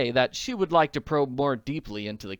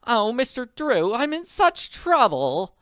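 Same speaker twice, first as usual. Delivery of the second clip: much higher, louder, slow fluttery lady in distress; distressed widow; 13 semitones higher, 8 dB louder, slower than usual. A narrator's reading. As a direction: plead like an anxious society lady. Distressed visitor voiced.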